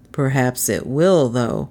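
a hint of a low-voiced policeman; suspicious policeman. A narrator's reading. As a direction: answer low and doubting. Policeman answers the girl.

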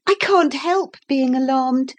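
put-on high, earnest young girl; a girl reassuring herself in the flood; little girl voice. A narrator's reading. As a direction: speak up as a sensible child. Narrator voicing Lucy.

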